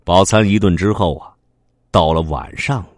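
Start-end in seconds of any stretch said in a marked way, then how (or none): none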